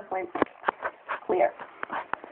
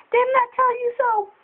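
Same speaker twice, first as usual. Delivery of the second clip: mock told-you-so dad; 11 semitones higher than usual. Dad scolding the kid.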